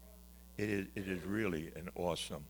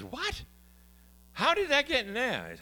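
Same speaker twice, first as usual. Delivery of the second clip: baffled; 14 semitones higher, 5 dB louder than usual. Retold or imitated. Jim baffled on the phone.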